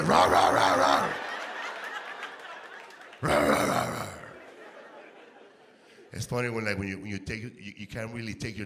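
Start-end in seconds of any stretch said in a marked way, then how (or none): 0.0-4.1 loud, low barking dogs; gruff mock barks; imitated dog barking. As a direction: bark like angry dogs.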